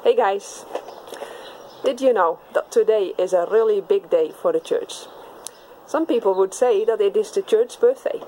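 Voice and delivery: ordinary talk, nothing marked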